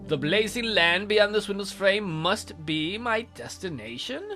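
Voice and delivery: ordinary delivery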